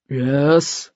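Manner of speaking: stentorian actor. Acted. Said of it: "his voice stentorian".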